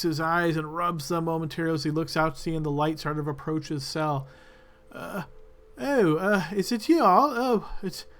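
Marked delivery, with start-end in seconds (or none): 4.9-8.1 much higher, confused and hesitant voice; a dazed prisoner squinting at visitors, voiced high; Alan the prisoner. Prisoner Alan recognizing the adventurers, high and bewildered. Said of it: "you recognize Alan".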